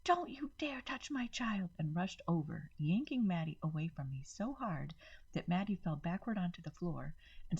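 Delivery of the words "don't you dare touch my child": higher, hissed protective threat; angry mother defending her daughter; furious mother. A narrator's reading. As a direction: snap it at the woman touching her child. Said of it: "Rose screamed".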